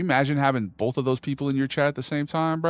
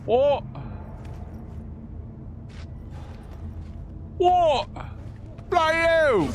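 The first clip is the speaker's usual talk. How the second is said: shocked outburst; 11 semitones higher, slower than usual. Real, not acted. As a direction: caught off guard in the game, disbelief building. Shocked by something in game.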